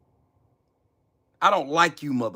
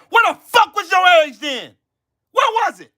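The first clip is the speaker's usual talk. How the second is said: screamed high and loud, voice cracking; furious shout; 13 semitones higher, 4 dB louder than usual. Real, not acted. Demanding where their outrage was, furious scream. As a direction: shout it at them with full fury.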